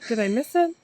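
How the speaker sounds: normal talking voice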